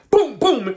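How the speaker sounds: loud high boom boom gunshots; mock gunshots, loud and high; gunshot sounds. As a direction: blast out each shot. Imitating father's gunshots.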